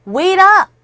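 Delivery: calling out. Shown as a demonstration. Teacher acts out a friend calling.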